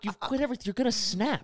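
high, pushy imitation of the sponsor; mock sponsor warning. Sponsor scolding him on a drive, high mock voice. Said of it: "He's like".

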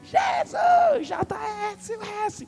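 very high, loud scream for Jesus; shouting. Retold or imitated. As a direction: yell desperately to be heard. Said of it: "ela começou a gritar". (gritando bem alto)

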